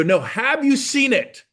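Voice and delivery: insistent challenge. Retold or imitated. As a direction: press him hard on it. Pressing friend in retold exchange.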